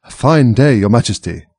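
ordinary delivery, nothing marked

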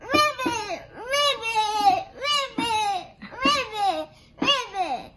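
带着孩子们学青蛙叫; 一声声呱呱叫给孩子们听; frog croaks for kids. Shown as a demonstration. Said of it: "Let's do it together".

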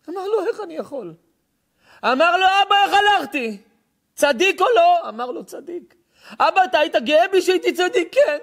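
high, loud sermon cry through the dialogue; impassioned preaching. Acted. Preacher voicing the dead son's plea to his father.

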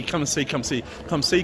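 ordinary talk, nothing marked

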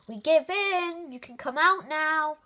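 high, loud call. A narrator's reading. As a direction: call out to someone hidden. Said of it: "called Elmer".

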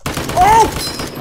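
high, loud yelp; real sudden "Oh!"; startled yell. Real, not acted. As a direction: cry out in shock. Startled during a fight.

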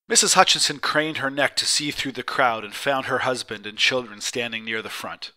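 usual speaking voice

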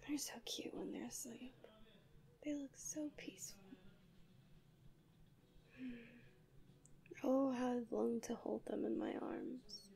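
tender, very soft whisper. Acted. Angel admiring her sleeping human, hushed whisper. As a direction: barely breathe it over the sleeper.

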